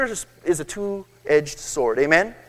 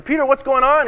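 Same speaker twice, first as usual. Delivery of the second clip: higher-pitched call down to someone; mild mock yell; 6 semitones higher than usual. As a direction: lightly imitate yelling from above. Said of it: "Gabriel yells down to Peter".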